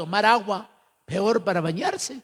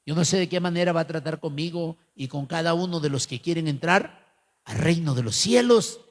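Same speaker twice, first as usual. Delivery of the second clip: usual speaking voice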